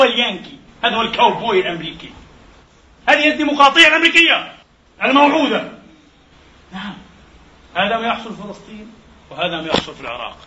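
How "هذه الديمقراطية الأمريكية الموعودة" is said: high, loud, fast indignant cry; scornful outcry. Real, not acted. Preacher denounces American democracy in a loud outcry. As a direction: spit it out much higher and louder.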